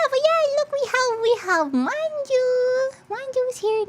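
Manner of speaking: very high, cutesy presenting voice; high cooing introduction. Real, not acted. Streamer showing Manju to viewers, high cooing. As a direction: coo it way up high, showing off a little friend.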